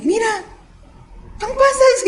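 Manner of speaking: retelling her own disappointed discovery; dismayed surprise. Retold or imitated. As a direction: squeak it out as if unpleasantly surprised. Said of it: "Abro la bolsa y digo".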